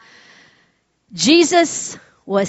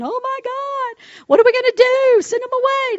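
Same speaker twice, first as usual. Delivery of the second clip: high-pitched imitation of someone panicking; hammed-up panic, pitched way up; mock freak-out; 13 semitones higher than usual. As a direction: play at losing it in a high wail. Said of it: "freaking out himself".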